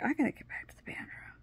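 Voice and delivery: fast, hushed whisper to self; urgent whisper. Retold or imitated. Telling herself to leave the scene, urgent whisper. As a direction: whisper it quickly, needing to escape. Urgent thought while turning away.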